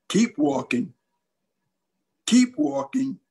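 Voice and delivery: ordinary delivery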